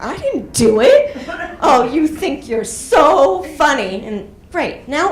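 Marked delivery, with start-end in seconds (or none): 0.0-1.1 louder, half-laughing protest; laughing denial. Retold or imitated. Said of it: "I'm like". 1.6-4.0 louder, slow, wounded reproach; mother scolds through tears; hurt mother. Retold or imitated. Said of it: "she's crying".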